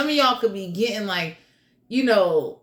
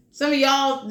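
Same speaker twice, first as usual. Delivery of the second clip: usual speaking voice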